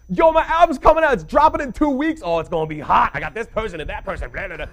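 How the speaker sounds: loud high hype voice. Retold or imitated. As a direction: sell the album like it's the hottest thing. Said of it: "And then you'll be like".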